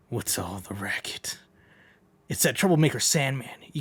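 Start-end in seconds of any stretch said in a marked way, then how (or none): 0.1-1.4 whisper. Acted. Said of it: "let's try that again".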